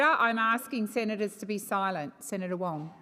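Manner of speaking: normal talking voice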